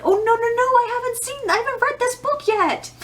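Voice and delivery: retold panic. Reader realizing the movie spoils unread books, high squeaky mock panic. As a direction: yelp it as if a spoiler is landing on you. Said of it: "And I was like".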